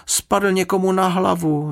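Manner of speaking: high-pitched, alarmed report; exclaiming. A narrator's reading. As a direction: cry out the news in a high voice. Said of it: "vykřikl Jonatán". (vzrušený vysoký výkřik)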